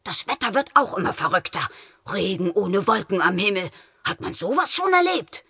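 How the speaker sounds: put-on high, puzzled grumble of an old man; grumbling old man. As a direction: grumble at the strange weather. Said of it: "der verärgert seinen Regenschirm aufspannte".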